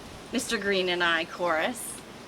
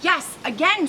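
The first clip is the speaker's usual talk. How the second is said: high, loud bird squawk; squawking pigeon; 7 semitones higher, 4 dB louder than usual. Acted. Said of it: "squawks Percy".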